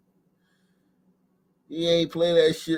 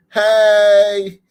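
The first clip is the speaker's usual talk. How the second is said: mock flirty hey; 3 semitones higher, 10 dB louder than usual. Acting flirty greeting to Obama.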